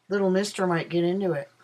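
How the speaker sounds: usual speaking voice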